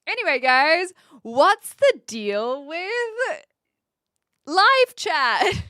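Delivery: loud, drawn-out observational comedy setup; hammed-up comic working the crowd; stand-up comic. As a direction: punch it out slowly like a bad stand-up. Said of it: "train as a stand-up comic".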